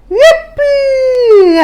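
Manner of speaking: high, loud, long-held whoop; jubilant cry. A narrator's reading. Tyrannosauruses cheering as the tree falls, jubilant cry. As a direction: cheer it out and hold the last vowel. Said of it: "all the trees cried out".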